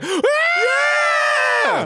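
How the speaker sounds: very high drawn-out mock cheer; imitated crowd roar in falsetto. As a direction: scream it high like a club crowd. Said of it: "the crowd's like".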